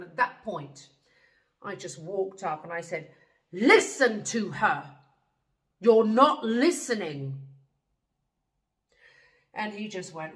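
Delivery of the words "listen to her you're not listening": loud, slow, forceful order; commanding tone. Retold or imitated. Traveler confronting a trickster on another woman's behalf, commanding. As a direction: drive each word home loudly and slowly. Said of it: "it was my tone of my voice".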